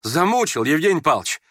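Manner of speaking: high, agitated demand; abrupt, urgent question. A narrator's reading. Youth suddenly turning on Yevgeny Pavlovich, urgently asking. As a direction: jump at him with the question. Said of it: "перескочил он к нему с вопросом".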